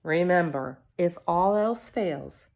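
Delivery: plain reading voice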